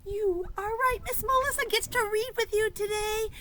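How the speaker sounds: high, loud cheerful reveal; excited reveal. Acted. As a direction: announce it like a big surprise.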